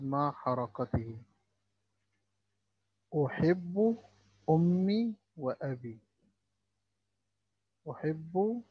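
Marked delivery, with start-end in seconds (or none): 3.3-8.6 أحب with the ب doubled and held; doubled letter stress. Shown as a demonstration. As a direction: lean on the shadda in أحب. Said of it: "ده حرف مشدد". (نطق الحرف المشدد مرتين)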